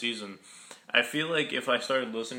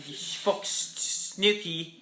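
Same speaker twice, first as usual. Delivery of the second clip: mock British accent; 6 semitones higher, slower than usual. Mocking British reality show quarrel.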